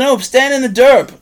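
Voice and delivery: imitating the kindergartner; little kid.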